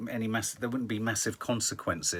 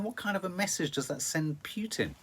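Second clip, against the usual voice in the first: ordinary talk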